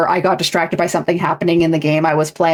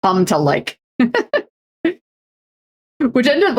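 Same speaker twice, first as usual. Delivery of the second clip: normal talking voice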